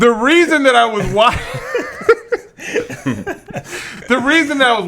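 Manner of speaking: cracking up mid-sentence, pitch jumping up; trying to explain through laughter; laughing while defending himself to friends. Real, not acted. Guys tease him, he laughs.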